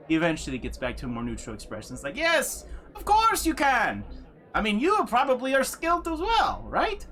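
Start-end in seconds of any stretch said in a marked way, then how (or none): 2.2-7.1 high, over-sweet voice hiding a sneer; forced politeness. Acted. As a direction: sound gracious while barely hiding contempt. NPC hides his sneer.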